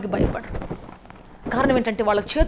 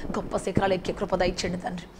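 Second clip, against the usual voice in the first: soft, low, whispered ending to the prayer; hushed closing plea; 4 semitones lower, 6 dB quieter than usual. Acted. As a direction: breathe the last words to the Father.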